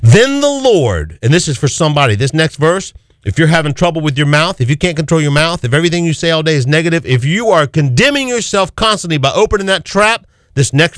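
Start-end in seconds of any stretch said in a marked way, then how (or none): none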